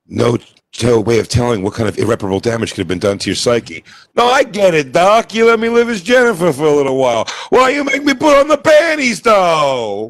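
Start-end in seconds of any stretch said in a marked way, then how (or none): 4.1-10.1 high, louder put-on voice of a grown man realizing; high mock Shane Coyle. Co-host mocking Shane Coyle, high and louder. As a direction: squeal it out like a man discovering he's an adult.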